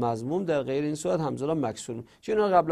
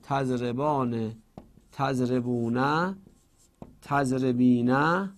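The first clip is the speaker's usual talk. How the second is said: slow dictation; 4 semitones lower, much slower than usual. Shown as a demonstration.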